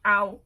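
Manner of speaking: sudden loud ow from burnt tongue. Real, not acted. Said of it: "I just burned my tongue".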